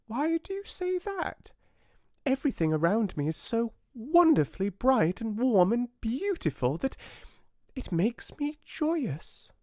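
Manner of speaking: high, piping tiny fly voice; little fly. A narrator's reading. Tiny fly questions the oak, high piping voice. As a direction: squeak it out like a tiny insect. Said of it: "the little creature would always reply".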